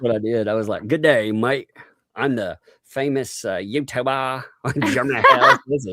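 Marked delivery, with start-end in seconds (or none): 0.9-5.6 higher mock Aussie voice; badly faked Australian accent, close to laughing. Posing as the prank caller, mock Aussie accent. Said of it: "can do an Australian accent".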